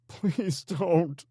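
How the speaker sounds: high, loud desperate plea; pleading. A narrator's reading. Crying captive pleading with the gang leader. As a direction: beg for his wife's picture. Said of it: "he pled".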